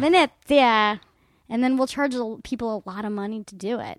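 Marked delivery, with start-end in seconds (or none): 0.0-1.0 slow, drawn-out Italian Venezia; mock Italian. Echoing Italian title, drawn out.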